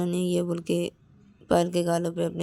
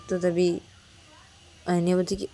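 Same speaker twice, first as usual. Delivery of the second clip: plain reading voice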